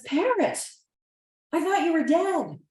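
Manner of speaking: high-pitched disbelief; astonished. Acted. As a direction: cry out in amazement, pitch leaping up. Man astonished parrot is alive.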